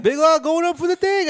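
mock play-by-play call of his own move; sports announcer. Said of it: "announcing his own basketball game".

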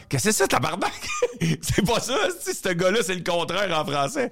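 high-pitched, softened incredulous reaction; high, laughing mock bewilderment; retold disbelief. Retells his amazed reaction to Derek.

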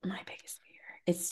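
hushed confession; whisper. Real, not acted. Telling her cohost her fear, whispered. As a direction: whisper it like a secret.

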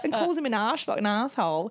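ordinary delivery

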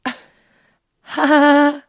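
usual speaking voice

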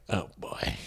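unvoiced mocking whisper. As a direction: breathe it out, bored and sneering. Mock inner reaction in class.

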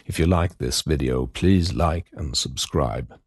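low, steady sign-off read; podcast outro. Acted. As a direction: read the closing credits.

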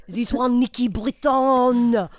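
lightly hammed French accent; a comic Frenchman being interviewed. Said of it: "Pierre Jambon".

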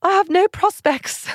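high, mock-dramatic period lament on "I have no prospects"; Pride and Prejudice heroine. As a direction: sigh it like a lovelorn Austen heroine. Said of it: "in Pride and Prejudice".

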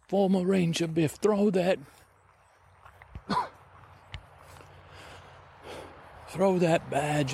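high, lilting adventurer urging on; Kiwi Shavers character. Acted. Adventurer urging former ranger to drop his badge, high lilting voice. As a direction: coax in a high, put-on oddball voice.

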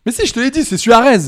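loud, high-pitched insistence; indignant protest. Real, not acted. Quiz player insisting the answer was already given, indignant protest. Arguing over who answered first.

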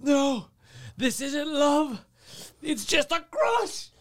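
high, drawn-out wailing meltdown; freaking out. Retold or imitated. Dater melting down over a crush, hammed-up freak-out. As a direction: wail each word slowly, hammed-up anguish. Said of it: "he was freaking out".

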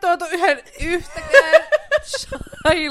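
loud, high, drawn-out wail with laughter; wailing indignantly while cracking up; laughing complaint. Real, not acted. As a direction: protest slowly through laughter. Complains nobody brought her chai.

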